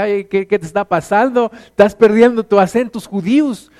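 mock teasing a fraud who lost his accent. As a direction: tease him about the lost accent. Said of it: "Yo decía".

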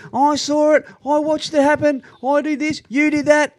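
high mocking bystander chatter; mock bystander voices. Mocking bystanders filming the fight, high and mocking. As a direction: pitch it up, mock the phone-wielding onlookers.

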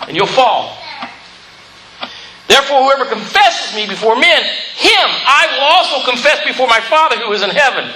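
forceful, emphatic pulpit delivery; preaching. Real, not acted. Preacher reading the verse to the church, forceful preaching. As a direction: declare it firmly to the congregation. Preacher declaims and reads scripture.

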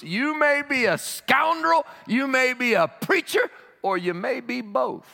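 high, exuberant preacher's roll call; full-force preaching. Real, not acted. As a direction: ring out each word to the congregation.

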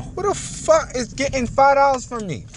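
outraged at a stranger taking money; furious disbelief. Real, not acted. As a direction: blurt out in shocked anger. Sees money being taken.

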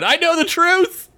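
high-pitched, wailing protest; mock anguish. Rejecting the co-host's reassurance, mock anguish.

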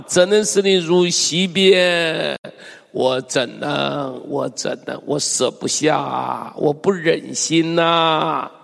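slow, drawn-out aching appeal; pleading lament. Acted. Preacher reads God's plea to Ephraim, lamenting. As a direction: stretch each line ending like a sigh. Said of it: "你都要用这种语气来读".

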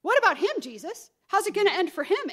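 high, loud, quick questions; nosy questioning. Acted. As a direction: imitate a nagging, put-out questioner. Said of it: "Peter's wondering how it's gonna end".

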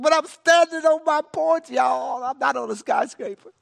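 high, loud exasperated insistence; retold loud protest. Player reminding everyone he is only on a porch, loud mock protest.

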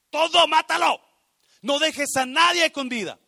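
sudden high, loud shout; explosive full-voiced decree; God's fierce command. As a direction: bellow the order as God. God orders destruction, acted.

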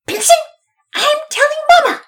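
very high, loud tattling scream; at the last straw. A narrator's reading. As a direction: screech a threat to tell. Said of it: "that was the last straw".

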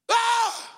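sharp high yelp of alarm; startled cry. Retold or imitated. Preacher reliving panic braking in the fog, startled yelp. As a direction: cry out as the brakes slam. Slamming brakes on foggy freeway.

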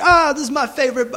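put-on high, botched British accent; bad British accent. Imitating a British guy at the bar, badly faked British accent. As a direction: attempt a British accent and miss. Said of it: "I can't do a fucking accent for shit".

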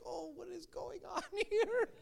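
high, soft mock girlish voice; small, high voice of confused onlookers; bewildered little girls. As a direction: imitate timid girls, breaking into a laugh. Imitating bewildered young girls.

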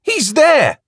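high, loud eager exclamation; breathless with excitement; excited. A narrator's reading. Woman at the window excitedly pointing him out.

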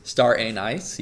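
"anise" repeated as AY-nice; "anise" with both vowels long; echoed AY-nice pronunciation. Retold or imitated. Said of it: "That's a pretty good pronunciation for it".

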